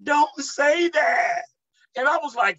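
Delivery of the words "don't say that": high, drawn-out wail, mildly mock; pleading demon cry, imitated. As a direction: whine it slowly like a weeping demon. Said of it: "That demon said".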